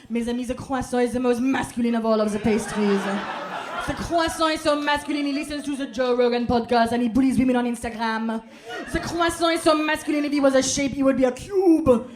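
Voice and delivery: loud, grand mock French delivery; over-the-top French showman; hammed-up French orator. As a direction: preach about the croissant like a pompous Parisian.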